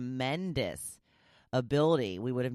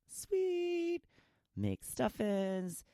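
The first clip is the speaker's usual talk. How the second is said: sing-song high; 8 semitones higher, 3 dB quieter, much slower than usual. Pretend. Whispers then sings the name.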